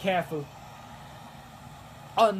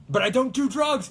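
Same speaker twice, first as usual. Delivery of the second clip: defensive shout; 7 semitones higher, 5 dB louder than usual. Pretend. Imagined defensive line to parents.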